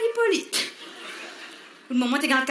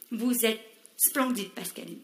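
low, slow and hushed, laying on the charm; a slow, soft, crooning compliment; flattering compliment; 6 semitones lower, 7 dB quieter, slower than usual. Acted. Director charming the singer's girlfriend, low and slow.

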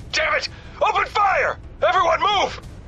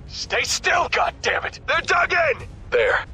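ordinary talk, nothing marked

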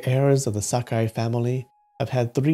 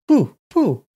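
high-pitched, louder puffed syllables; high exclamation; 11 semitones higher, 5 dB louder than usual. Acted. Shuju at the foot of the slope, high exclamation. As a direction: puff out each word high and punchy.

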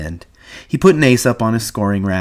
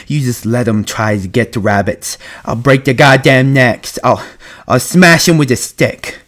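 rising to a loud, higher outburst; angry threat; 4 semitones higher, 7 dB louder than usual. A narrator's reading. Lenny threatens cats that might hurt rabbits, angry. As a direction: work yourself into a childlike rage.

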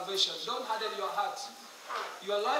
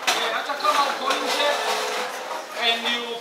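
loud preacher's summons over the crowd; loud altar call; 3 semitones higher, 8 dB louder than usual. Acted. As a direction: call them forward with urgency. Loud altar call to those outside.

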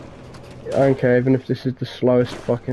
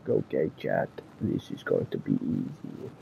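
much lower, softer, slow put-on; mock deep voice; 10 semitones lower, 13 dB quieter than usual. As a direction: drop into a silly deep voice. Mocking voice to chat.

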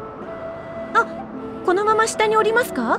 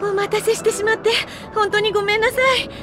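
higher-pitched, hurried-in apology; flustered apology; 6 semitones higher than usual. Acted. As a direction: apologize earnestly for being late. Late arrival apologizes for keeping them waiting. (慌てた申し訳なさそうな声)

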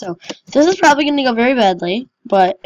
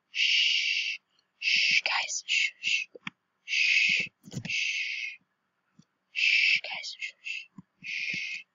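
whispered shh; soft repeated shushing; 13 dB quieter, much slower than usual. Real, not acted. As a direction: hush everyone with whispered shushes. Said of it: "Guys, shush".

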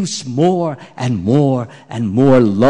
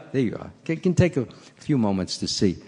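ordinary delivery

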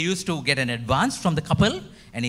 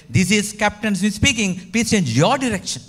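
higher and louder megaphone call; raised voice; 6 semitones higher, 4 dB louder than usual. Acted. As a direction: raise your voice to make him obey. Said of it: "raised the voice and said".

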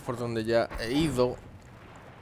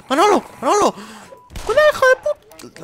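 high loud shout; frantic high yell; yelling warning; 8 dB louder, faster than usual. Pretend.

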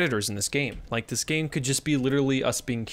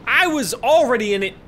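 louder, pitched up in alarm; startled outburst; 9 semitones higher, 8 dB louder than usual. Real, not acted. Protesting at the game's late tutorial, startled and loud. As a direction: yelp it, caught off guard.

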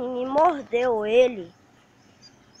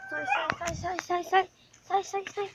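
soft shooing; 7 semitones higher, 9 dB quieter than usual. Real, not acted. Shooing the puppies away.

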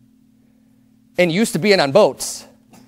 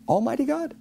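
awed hush; 7 semitones higher, 9 dB quieter than usual. Acted.

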